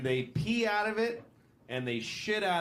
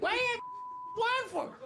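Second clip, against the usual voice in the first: high yelled accusation from the duck wife; a wife catching her husband, yelling; angry wife yell; faster than usual. Acted. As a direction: shriek at the cheating husband.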